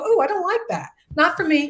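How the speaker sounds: mock dismissive objection. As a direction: play someone turning down a show they dislike. Acting out an offended viewer.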